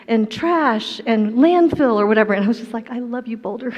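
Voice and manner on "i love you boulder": ordinary delivery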